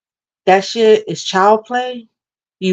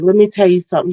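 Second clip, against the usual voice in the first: normal talking voice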